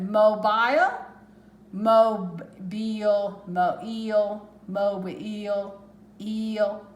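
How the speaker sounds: "mobile" drawn out, ending in "eel"; trying sounds. Shown as a demonstration. Teacher sounding out the word for students. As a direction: stretch "mobile" slowly to test the last vowel. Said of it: "which is really hard to say".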